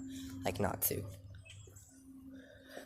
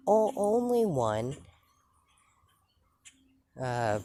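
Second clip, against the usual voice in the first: flustered admission; 5 semitones higher, 6 dB louder, slower than usual. Retold or imitated. Mother nervously admits to son.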